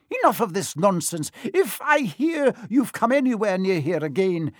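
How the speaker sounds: high, indignant teacher's scolding; outraged telling-off, key words drawn out; losing her temper. A narrator's reading. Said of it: "she lost her temper".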